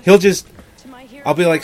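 ordinary talk

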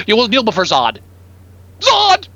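mock booming villain decree; hammed-up menacing warlord; booming demon lord. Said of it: "Zod is serious comic book".

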